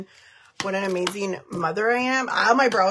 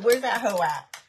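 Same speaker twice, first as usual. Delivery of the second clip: ordinary delivery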